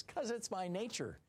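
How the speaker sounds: high, smug mock scorpion voice. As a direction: play the scorpion shrugging it off. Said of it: "the scorpion says".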